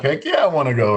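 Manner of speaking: high, bright correction with heck yeah; cleaned-up excited. Retold or imitated. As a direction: redo it polite but still thrilled. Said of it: "So, excuse me".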